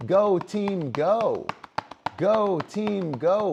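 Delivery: high, loud mock cheer chant; mock cheerleader. Mocking cheerleaders chanting at the crowd, high and loud. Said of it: "We've warmed up the crowd".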